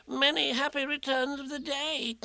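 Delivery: small, very high squeaky voice; Piglet. A narrator's reading. Piglet repeating birthday wishes to Eeyore, high squeak. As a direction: pipe the greeting up high. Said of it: "said Piglet again".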